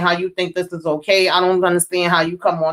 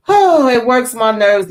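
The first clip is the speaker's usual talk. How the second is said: exasperated groan; 4 semitones higher, 6 dB louder than usual. Real, not acted. Venting frustration about show.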